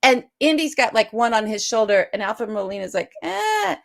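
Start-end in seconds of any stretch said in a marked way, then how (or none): 3.2-3.8 mock panicked gasp. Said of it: "he's freaking out".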